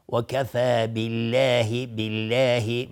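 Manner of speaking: slow recited بالله with a light L; thin L. Shown as a demonstration. Teacher showing the correct way, thin L in بالله. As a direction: keep the L of بالله thin and draw it out. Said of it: "نازک تلفظ".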